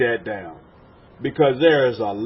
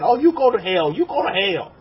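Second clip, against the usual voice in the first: raised, louder imitation of a finger-pointer; mock-righteous cry, pitched up; mock condemnation; 5 semitones higher, 4 dB louder than usual. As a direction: mimic someone damning people to hell. Mimics people condemning others.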